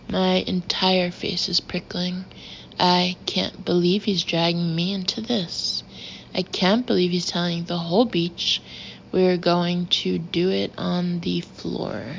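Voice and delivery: usual speaking voice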